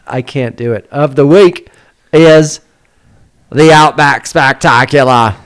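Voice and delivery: a slow, hammed-up Australian announcer; Australian accent. Host announces the sponsor in a put-on Australian accent. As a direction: sell the sponsor like a broad Aussie showman. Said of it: "I brought this accent".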